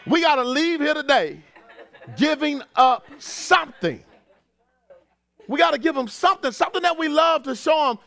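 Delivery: lively pulpit push; preaching. Acted. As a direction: urge them to fast. Preaching rises again.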